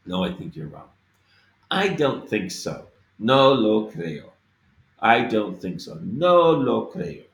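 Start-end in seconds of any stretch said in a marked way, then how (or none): 3.2-4.3 No lo creo slow and firm, loud on No. Shown as a demonstration. Teacher modelling No lo creo as said in real speech. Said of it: "I say it that way because oftentimes in speech". 6.0-7.2 No lo creo slow and firm, loud on No. Shown as a demonstration. Teacher modelling No lo creo as said in real speech. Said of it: "I say it that way because oftentimes in speech".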